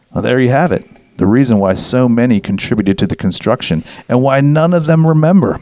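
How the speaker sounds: normal talking voice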